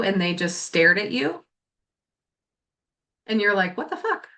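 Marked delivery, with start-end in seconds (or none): 3.8-4.3 baffled, high and soft; high puzzled "what the fuck"; confused. Pretend. Acting out confusion at being ignored.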